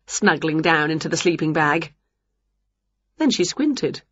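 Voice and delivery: usual speaking voice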